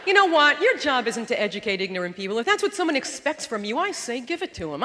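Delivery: ordinary talk, nothing marked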